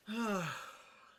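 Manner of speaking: long, heavy sigh; exasperated sigh. Real, not acted. User weary of arguing with a chatbot, exasperated sigh. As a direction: let out a big fed-up sigh.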